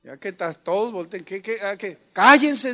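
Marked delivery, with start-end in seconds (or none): 2.1-2.7 loud high command; shouted order. Shown as a demonstration. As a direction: snap it out loud.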